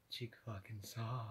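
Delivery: soft, slow line, trying not to laugh; stifled laugh. Retold or imitated. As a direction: say it quietly while holding back a laugh. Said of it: "she was trying not to laugh".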